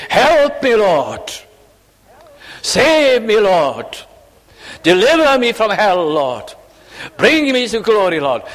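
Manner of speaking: voicing a sinner crying aloud for salvation. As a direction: cry it out to the Lord. Crying prayer, preacher at full voice.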